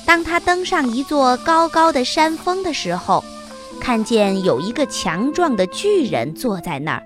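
ordinary delivery

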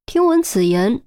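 usual speaking voice

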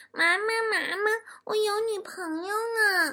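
high, slow kiddie voice; high, soft kindergartner voice, drawn out; excited little boy. Acted. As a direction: put on a small child proudly telling mum. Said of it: "熊孩子回家兴奋地对我说".

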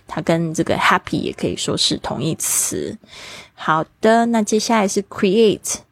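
ordinary talk, nothing marked

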